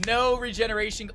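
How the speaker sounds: normal talking voice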